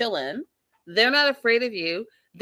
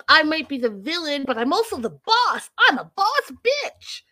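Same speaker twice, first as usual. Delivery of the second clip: high, loud mock defiance; hammed-up boastful comeback; [name]; 8 semitones higher, 5 dB louder than usual. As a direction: brag it back, getting higher and drawing out the end. Acted defiant retort of [name].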